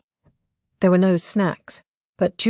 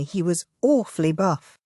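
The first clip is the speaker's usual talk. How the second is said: ordinary delivery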